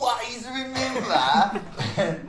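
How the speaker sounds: laughing. Pretend. Singing while laughing.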